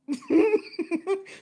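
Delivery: a loud, high burst of laughter. Real, not acted. Remembering the setup, high laughing burst. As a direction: crack up at the memory.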